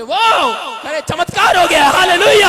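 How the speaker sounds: loud, high shout of joy at the miracle; woman celebrating her healing with a loud high shout; excited shout. Retold or imitated. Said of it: "कहते".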